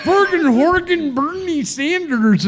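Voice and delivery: very high, slow, cartoonish fake Swedish; botched Swedish accent. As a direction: do a Muppet-style Swedish accent, badly. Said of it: "Like the fucking Swedish stuff is what you sounded like".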